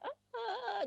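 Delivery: high sharp mock mother's shriek; mock angry shriek. Imitating a mother snapping at her child, mock shriek. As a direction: shriek it like a mom losing her temper.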